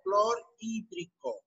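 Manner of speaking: slow, drawn-out, split into clor and hídrico; slow dictation. Shown as a demonstration. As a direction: say "clorhídrico" slowly in parts for the class. Dictating new term in parts. (dictado lento, por partes)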